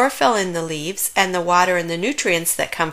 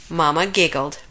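ordinary delivery, nothing marked

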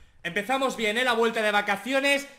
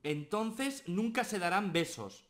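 soft secret; 7 dB quieter than usual. Acted. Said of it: "le dice a otra al oído".

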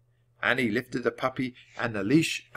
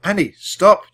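loud, higher urgent yell; 8 semitones higher, 10 dB louder, slower than usual. A narrator's reading. Jack yelling at Annie as the guard aims, loud. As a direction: warn her sharply. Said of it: "Jack yelled".

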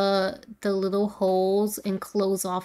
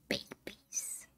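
gasp, then a breathless whispered "Babies"; hushed and delighted surprise; 10 semitones higher, 13 dB quieter than usual. Real, not acted. Player spots babies in the game, gasped whisper.

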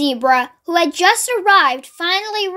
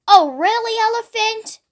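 higher-pitched, put-out zebra; exasperated cry; 6 semitones higher than usual. A narrator's reading. Zebra told his stripes are a disaster, exasperated cry. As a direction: protest with mild exasperation, pitch up. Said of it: "cried Zebra".

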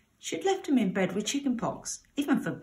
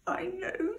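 high, soft, sobbing squeak; 11 semitones higher, 6 dB quieter than usual. Acted. Scolded pig answering his mother, sobbing. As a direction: squeak it out through tears. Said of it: "sobbed Belcher".

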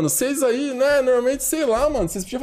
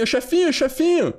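higher mock call for help; put-on plea, pitched up; pleading underlings; 5 semitones higher than usual. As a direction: call out to the boss, imitating a follower. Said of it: "Falar assim".

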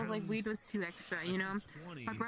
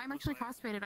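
ordinary talk

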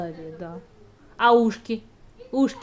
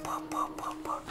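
whispered tractor putter; 11 dB quieter than usual. Shown as a demonstration. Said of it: "А как трактор делать?".

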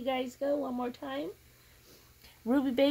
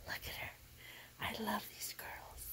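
hushed, much softer whisper; 3 semitones lower, 13 dB quieter than usual. Real, not acted. Admiring the doll, whispering softly. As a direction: murmur it tenderly under your breath. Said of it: "why I'm crying".

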